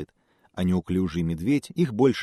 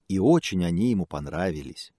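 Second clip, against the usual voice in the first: plain reading voice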